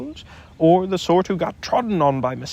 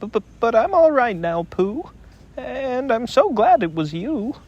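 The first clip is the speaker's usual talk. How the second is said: Piglet's voice; 8 semitones higher than usual. Acted.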